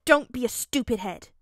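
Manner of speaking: put-on loud childish scolding; pouting. A narrator's reading. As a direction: snap back in a small-child sulk. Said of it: "he pouted".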